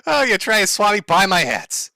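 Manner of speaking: ordinary talk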